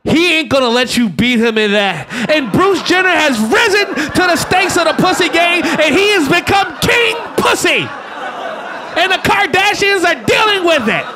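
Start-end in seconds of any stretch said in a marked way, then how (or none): 2.3-11.0 higher, shouted, grand tribute; mock hall-of-fame speech. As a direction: hail him like an awards host.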